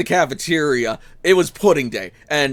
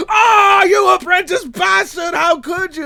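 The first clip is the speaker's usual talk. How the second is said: high, loud pained yell, slowing down; mock scream; 12 semitones higher, 4 dB louder, slower than usual. Gregor screaming at the apprentice with pudding in his eyes. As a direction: howl it like pudding is in your eyes. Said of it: "he's screaming".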